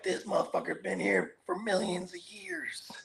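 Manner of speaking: hushed, slow whisper of wonder; awed whisper. Retold or imitated. Whispering in awe at a giant redwood. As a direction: breathe it out in awe. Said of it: "just thinking about".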